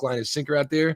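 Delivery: usual speaking voice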